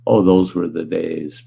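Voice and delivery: normal talking voice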